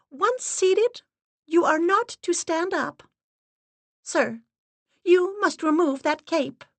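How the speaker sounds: high, stern, unvarying voice; steady monotone. Acted. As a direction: lay down the rules flat and firm. Assistant's held voice.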